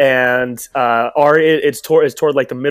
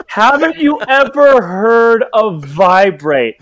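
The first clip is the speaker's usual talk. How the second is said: put-on slow, higher old woman, dragging out every word; mock old woman; 7 semitones higher, 4 dB louder, much slower than usual. Mocking the scripted old woman's line to his co-host, slow and exaggerated. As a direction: ham up the stiff scolding line, nice and slow. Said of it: "No one would ever say that".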